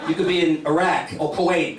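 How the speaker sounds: normal talking voice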